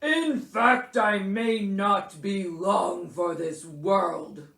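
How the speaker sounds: loud, slow, self-pitying bear; huffing Bear. A narrator's reading. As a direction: huff with grave self-importance. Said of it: "Bear huffed".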